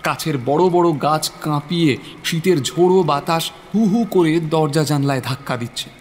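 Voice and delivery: ordinary talk, nothing marked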